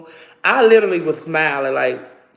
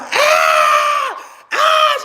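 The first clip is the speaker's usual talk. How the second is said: two long, high mock shrieks; piercingly high imitated screams; imitated screaming; 5 dB louder than usual. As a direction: scream it out loud, twice, mimicking her. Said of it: "She's screaming, laughing at me".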